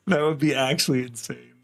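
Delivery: ordinary talk, nothing marked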